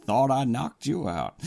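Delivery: ordinary talk, nothing marked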